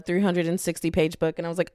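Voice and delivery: normal talking voice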